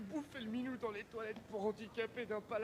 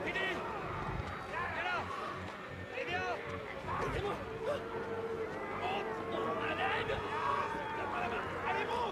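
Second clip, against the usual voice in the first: high, loud cries for help; urgent shouting; 8 semitones higher, 5 dB louder than usual. Acted. People in danger urge each other to climb, shouting urgently. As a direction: yell in short frantic bursts.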